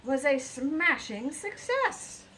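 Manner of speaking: loud, slow, proud flourish; triumphant. A narrator's reading.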